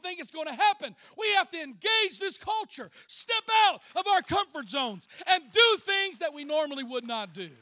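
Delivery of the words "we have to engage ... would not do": loud, high-pitched sermon exhortation; full-force preaching. Real, not acted. Preacher urging the church out of comfort zones, full-force preaching. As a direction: drive each phrase out to the congregation.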